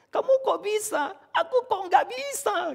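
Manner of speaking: put-on very high, childlike wonder; amazed child. Preacher imitating his son praising Josh, high childlike awe. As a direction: squeak it like a small kid in awe. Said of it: "Dia sering berkata sama Josh".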